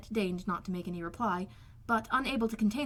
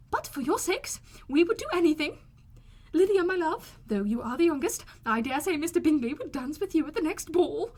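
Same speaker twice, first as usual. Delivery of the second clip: louder, higher, fussing mother; effusive Mrs Bennet; 6 semitones higher, 4 dB louder than usual. A narrator's reading. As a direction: fuss over the girls proudly.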